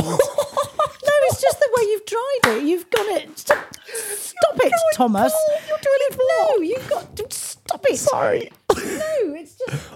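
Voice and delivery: laughing. Real, not acted. Laughing at husband's bald joke.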